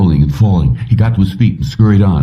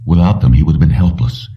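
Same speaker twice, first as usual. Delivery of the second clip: ordinary delivery, nothing marked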